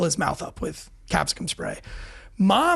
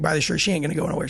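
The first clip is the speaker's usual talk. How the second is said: ordinary talk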